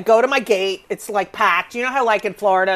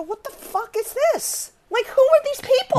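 outraged quote; 13 semitones higher than usual. Retold or imitated. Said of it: "I go".

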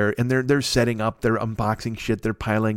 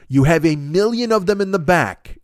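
slow, higher, incredulous recap; retold slow, pointed clarification; getting it straight; 5 semitones higher than usual. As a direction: spell it out word by word in disbelief. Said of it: "let me get this straight again".

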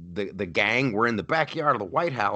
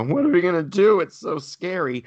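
high, whiny mock panic; mocking the scared folks at home; hammed-up fretting; mock scared whimper; 9 semitones higher, 5 dB louder than usual. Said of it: "the more you sit around and go".

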